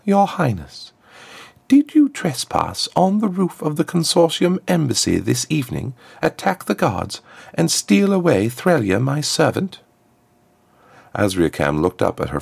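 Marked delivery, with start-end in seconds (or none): 0.0-9.8 high-pitched formal interrogation; high, smooth diplomatic voice; smooth ambassador, accusing. A narrator's reading. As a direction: question the princess like a polished prosecutor. Plays the ambassador questioning the princess.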